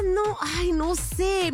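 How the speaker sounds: ordinary talk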